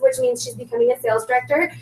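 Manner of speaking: ordinary talk, nothing marked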